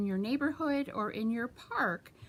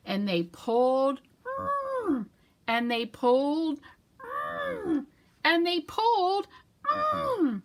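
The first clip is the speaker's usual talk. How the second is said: high strained hums after each pull; straining pulls; 8 semitones higher, 4 dB louder than usual. Acted. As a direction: push through the effort. Pulling with strain.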